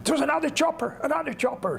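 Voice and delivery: high-pitched, sudden outburst; excited blurting. Retold or imitated. Pilot Gene spots rescue from the tent, excited high blurt. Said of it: "and Gene suddenly blurted".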